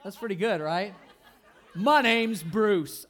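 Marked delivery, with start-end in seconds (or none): 1.7-3.1 slow, loud mock Australian drawl; fake Australian accent. Pastor jokes with a fake Australian accent, slow and loud. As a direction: stretch it out in a joke Australian accent. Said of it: "Okay, no, that's something else".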